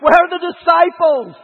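high, loud frantic cry for help; panicked cry. Retold or imitated. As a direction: yell it out in sudden panic. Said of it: "I'm like".

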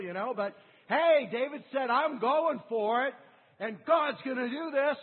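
loud, high, driving sermon voice; full-force preaching. Acted. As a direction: preach it hard and rising. Preaching builds on David.

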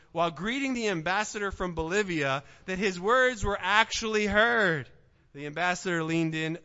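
ordinary talk

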